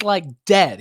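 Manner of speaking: ordinary delivery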